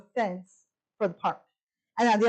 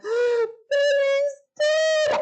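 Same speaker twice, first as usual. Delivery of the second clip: dramatic wail; 4 dB louder, much slower than usual. Retold or imitated. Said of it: "I'm doing the whole dramatic thing".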